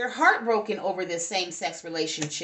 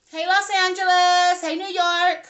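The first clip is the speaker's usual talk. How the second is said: high, louder sing-song hello; excited greeting; 8 semitones higher, 7 dB louder than usual. Real, not acted. Reader greets viewers in France with a high, loud, sing-song hello. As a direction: greet the viewers like friends across a room.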